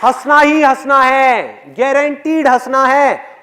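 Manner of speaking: loud, high, slow declaration; emphatic guarantee. Real, not acted. Speaker guarantees audience will laugh, loud and emphatic. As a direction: proclaim it slowly at full voice.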